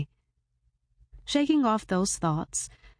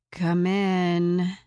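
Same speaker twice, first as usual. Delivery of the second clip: unenthusiastic. A narrator's reading.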